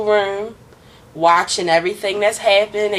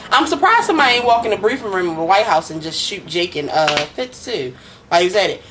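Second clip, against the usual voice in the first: ordinary talk, nothing marked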